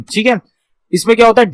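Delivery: ordinary delivery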